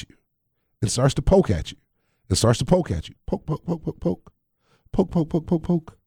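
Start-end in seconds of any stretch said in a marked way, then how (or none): 3.3-6.0 quick, staccato jabs, higher and softer; rapid-fire mimed prodding, small and high; playful poking. Pretend. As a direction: jab each poke out lightly, in little bursts. Acts out Satan poking at you.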